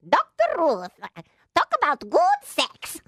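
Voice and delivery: put-on high, breathy German-accented little old lady; Doctor Ruth impression. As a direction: do a tiny German sex therapist. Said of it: "My best impression?".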